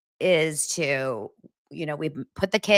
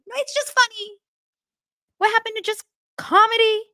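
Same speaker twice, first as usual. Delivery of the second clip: mock exasperated mom; 4 dB louder than usual. Mother insisting to her son it's funny, high pleading. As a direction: plead it up high, half-laughing.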